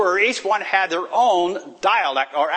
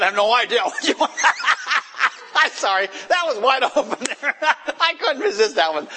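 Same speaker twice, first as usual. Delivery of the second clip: higher, breaking into laughter; laughing tease; 8 semitones higher than usual. Real, not acted. Pastor teases congregant about her outfit, laughing. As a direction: get the joke out through laughs.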